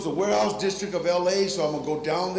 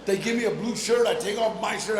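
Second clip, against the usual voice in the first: usual speaking voice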